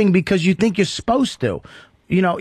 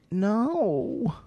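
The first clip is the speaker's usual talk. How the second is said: soft, slightly raised "no"; different kind of no; 3 semitones higher, 7 dB quieter than usual. Shown as a demonstration. Host showing a guest there are different no's, soft no. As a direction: let "no" out quietly. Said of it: "now there's different no's".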